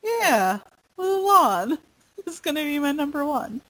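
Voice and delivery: tearful giggle over her favorite film; teary laugh. Real, not acted. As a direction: let the laugh crack with emotion. Teary laughter after Mulan.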